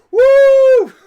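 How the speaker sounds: very high, loud held woo; mock celebratory whoop. Co-host greeting James's return, imitated whoop. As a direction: let out an over-the-top whoop.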